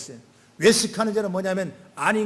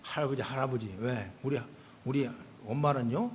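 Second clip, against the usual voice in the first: grandson's voice; 7 semitones lower, 8 dB quieter than usual. Retold or imitated. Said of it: "나한테 이러는 거예요".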